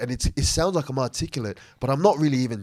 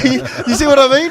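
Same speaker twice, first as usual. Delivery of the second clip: laughing; 8 semitones higher, 10 dB louder, faster than usual. Real, not acted. Laughing at his own point.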